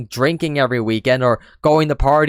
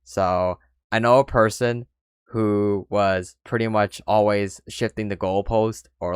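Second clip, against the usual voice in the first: ordinary talk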